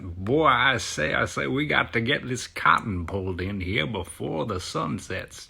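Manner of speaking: booming, drawn-out cartoon Southern accent; hammed-up cartoon Southern drawl; Foghorn Leghorn. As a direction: do a blustering fake-Southern rooster accent, loud and slow. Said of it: "I don't want to hear a Foghorn Leghorn accent".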